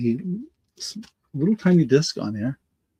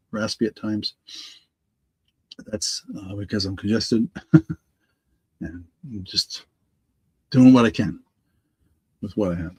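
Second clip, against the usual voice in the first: ordinary talk, nothing marked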